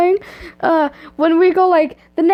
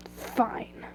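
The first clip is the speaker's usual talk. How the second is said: low-volume, grudging growl; 3 semitones lower, 14 dB quieter than usual. A narrator's reading. Enemy giving in to a request to stay, hushed growl. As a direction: growl it under your breath. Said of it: "he growled".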